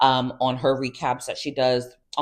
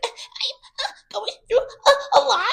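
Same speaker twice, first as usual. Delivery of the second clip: very high, slow, laughing mock whimper; mock crybaby; slower than usual. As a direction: parody her self-pity in a squeaky voice. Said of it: "It's like".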